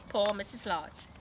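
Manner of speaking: usual speaking voice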